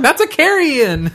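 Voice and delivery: high, slow insistent correction; mock coworker. Imitating the coworker insisting on carry-in, high and slow. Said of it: "And she's like".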